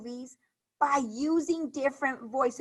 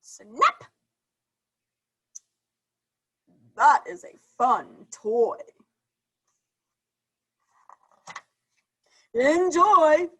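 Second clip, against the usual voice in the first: put-on slow, lightly lowered girl's voice; a hint of a deeper-voiced new friend; deeper character voice; 3 semitones higher, 6 dB louder, slower than usual. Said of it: "different voice".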